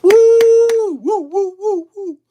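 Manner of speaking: high whooping cheer with a laugh; excited whoops. Real, not acted. Streamer cheering a viewer who just joined, high whoops. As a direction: whoop it up for a friend.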